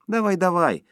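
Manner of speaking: high, loud prodding; urging. A narrator's reading. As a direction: egg him on impatiently. Wife urging hesitant husband on.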